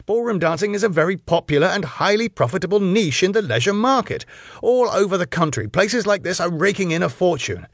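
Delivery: high, insistent argument; baffled protest. A narrator's reading. Husband defends ballroom business to wife, baffled protest. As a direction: argue the case, pitched well up.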